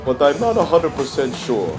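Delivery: ordinary talk, nothing marked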